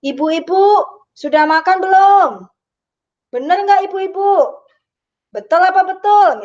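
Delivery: rally the crowd of mothers like a preacher; Mama Dede. Retold or imitated. Lecturer imitates a preacher rousing her audience, loud and high. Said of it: "lagi nonton ceramah Mama Dede".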